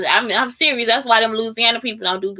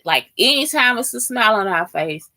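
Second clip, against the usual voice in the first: normal talking voice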